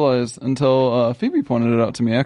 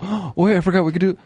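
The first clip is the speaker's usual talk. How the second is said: ordinary talk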